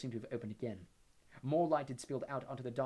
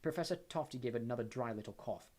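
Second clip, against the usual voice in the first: usual speaking voice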